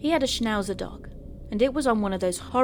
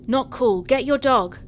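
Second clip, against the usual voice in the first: loud, higher-pitched angry protest; angry call; 5 semitones higher, 7 dB louder than usual. A narrator's reading. Walker to careless owner of attacking dog, angry call.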